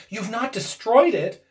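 ordinary delivery, nothing marked

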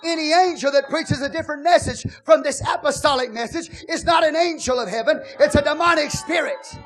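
loud, ringing preacher's cry over the music; full-force preaching. Acted. Preacher urging the church to speak to their souls, loud and fervent. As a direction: whoop it up like a preacher stirring the house.

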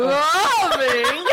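LOVING drawn out high, mimicking the reader; playful imitation of "loving" shouted in capitals; all-caps voice on LOVING. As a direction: swoop up through a long "loving", laughing. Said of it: "caps voice when you go".

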